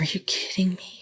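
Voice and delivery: breathy whispered are you kidding me; whispered disbelief. Retold or imitated. Whispering in disbelief to a friend about the audiobook.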